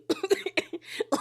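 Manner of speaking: a real burst of high, airless laughter; high breathy laugh. Real, not acted. Laughs at Demi's reaction.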